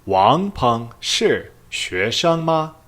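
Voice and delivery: slow, carefully spaced Mandarin, "ma" lifted at the end; teacher reads "Wang Peng shi xuesheng ma?" slowly for learners to copy; slow model question. Shown as a demonstration. Teacher models the question for students, slow and careful. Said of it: "the question would be".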